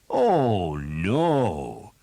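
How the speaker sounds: loud mock surprise; sarcastic captain feigning surprise; feigned surprise. A narrator's reading. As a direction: fake astonishment, sarcastic. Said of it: "in feigned surprise".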